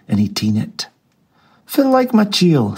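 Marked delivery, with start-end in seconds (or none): 1.7-2.8 jolly Scrooge. A narrator's reading. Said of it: "said Scrooge".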